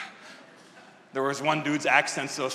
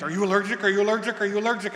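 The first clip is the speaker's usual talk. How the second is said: panicked doctor; 6 semitones higher, much faster than usual. Retold or imitated. Panicking medical staff, repeated question.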